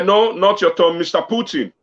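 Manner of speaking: ordinary talk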